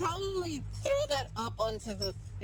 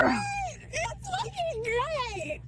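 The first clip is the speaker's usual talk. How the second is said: high laughing yell, slow; laughing shout; slower than usual. Real, not acted.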